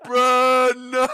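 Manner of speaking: loud high laughing protest; laughing disbelief. Real, not acted. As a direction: cry out through laughter. Laughing disbelief after kill.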